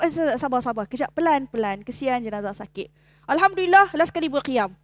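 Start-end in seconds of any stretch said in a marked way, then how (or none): 0.0-2.9 soft calming. Retold or imitated. Gently telling family to calm.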